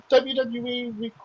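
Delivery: normal talking voice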